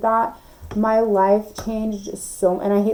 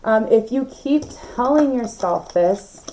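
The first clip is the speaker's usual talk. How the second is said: usual speaking voice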